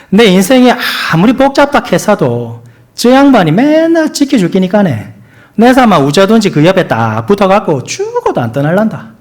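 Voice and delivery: 경상도 사투리로 읽기; Gyeongsang dialect. Acted. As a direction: read the psalm in broad Gyeongsang dialect. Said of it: "먼저 경상도 버전입니다".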